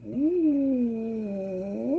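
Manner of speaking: high, floating ooh; woozy ooh. Retold or imitated. Tipsy brain reacts to the strong caipirinha with a high ooh. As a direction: let the drink hit your head. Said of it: "my brain kind of goes".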